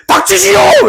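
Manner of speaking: high mock shout of command; hammed-up court official's outburst; emboldened. Chief secretary backed by the king silences rival, emboldened shout. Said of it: "기세 등등해져가지고".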